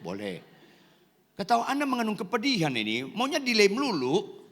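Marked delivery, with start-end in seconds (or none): 0.0-0.4 low, soft, half-hearted permission; half-voiced yes. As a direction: give a lukewarm, half-volume answer. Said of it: "Ya, setengah suara lah". (setengah suara, rendah dan pelan)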